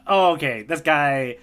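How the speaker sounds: ordinary delivery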